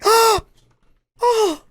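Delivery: clutching pearls gasp. Retold or imitated. Mocking offended Eastern European fans: high shocked gasp. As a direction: gasp in mock horror, voice leaping up. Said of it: "my Eastern European clutching pearl sound effect".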